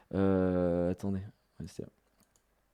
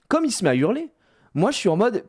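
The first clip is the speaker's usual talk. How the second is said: ordinary delivery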